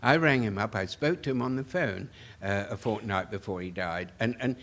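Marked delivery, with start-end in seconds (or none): none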